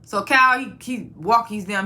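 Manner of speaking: usual speaking voice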